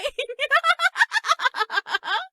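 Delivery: put-on a high, girlish giggle. Giggling too hard at senpai's joke.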